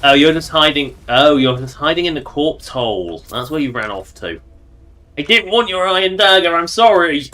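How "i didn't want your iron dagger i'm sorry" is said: higher, louder hammed-up sorry; mock apology. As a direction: pretend to beg forgiveness.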